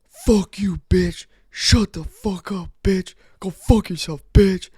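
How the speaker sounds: high, loud mock rage; imitated screaming. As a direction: imitate a man screaming insults, punching each phrase.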